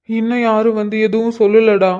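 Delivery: ordinary delivery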